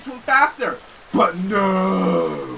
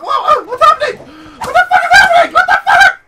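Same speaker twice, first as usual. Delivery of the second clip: very high, loud laughing yell; shocked shout; 10 dB louder than usual. Real, not acted. Reacting to the game going wild, shocked laughing shout. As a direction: shriek in disbelief at the chaos.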